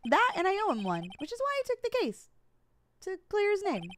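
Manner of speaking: put-on high small childlike lawyer. Rookie lawyer explains why he took the case, high childlike voice. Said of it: "my voice just cracked real bad there".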